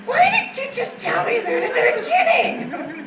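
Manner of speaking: high exasperated question, laughing; mock exasperation. Daughter exasperated with her rambling mom, high mock exasperation. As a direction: throw up your hands at her.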